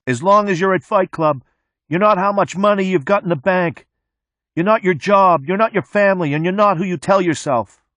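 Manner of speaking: high, loud yell into the wind. A narrator's reading. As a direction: shout each line over the traffic. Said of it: "The mechanic yells out the window".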